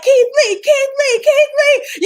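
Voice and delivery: high, loud screamed cheer of a name; imitating cheering. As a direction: shriek the name like a hyped-up fan. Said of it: "you're cheering them on".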